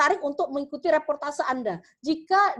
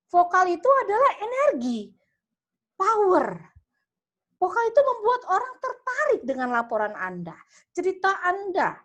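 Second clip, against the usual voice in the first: higher and louder with real energy; enthusiastic, full of power; 7 semitones higher, 3 dB louder than usual. Shown as a demonstration. Teacher showing students a strong voice, enthusiastic and louder. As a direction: sell every word with power. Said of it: "lebih antusias ... Ada power".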